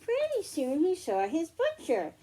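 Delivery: usual speaking voice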